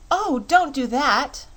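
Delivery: high, loud, slow plea; Dorothy crying out as her friend is transformed; cry of dismay. Acted. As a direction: cry out in shock at the change. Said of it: "cried Dorothy".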